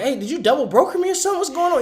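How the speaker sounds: high, fast irritated questioning; pissed off. Retold or imitated. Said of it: "I'm getting pissed".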